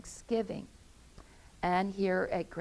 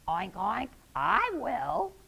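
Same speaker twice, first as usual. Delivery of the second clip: pig voice; 4 semitones higher than usual. Acted. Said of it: "Her pig friend said".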